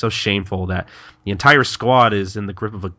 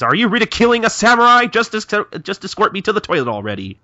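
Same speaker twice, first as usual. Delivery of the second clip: high, quick indignant protest; offended; 12 semitones higher, 5 dB louder, faster than usual. Acted. Kondo objecting to the mockery, offended. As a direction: protest in a hammed-up huff. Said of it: "Kondo takes offense to this".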